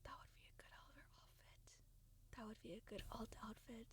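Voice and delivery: soft unvoiced whisper. Real, not acted. Streamer whispers an outfit idea to herself. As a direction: breathe it out as a private aside.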